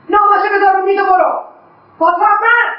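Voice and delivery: loud commanding order; stern shout. Acted. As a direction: bark the order across the room. Mother orders son to leave letter.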